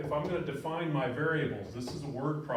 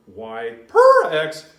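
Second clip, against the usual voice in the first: loud, slow, higher that's your slope; loud emphasis; 9 semitones higher, 14 dB louder, much slower than usual. Shown as a demonstration. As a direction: drive the point home loudly. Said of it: "Can't scream it enough".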